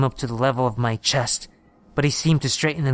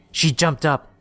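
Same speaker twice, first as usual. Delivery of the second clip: ordinary delivery, nothing marked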